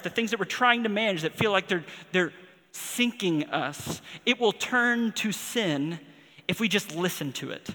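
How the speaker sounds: impassioned preaching, building intensity; rising preaching. Acted. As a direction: press the warning home from the pulpit. Preacher building to full force.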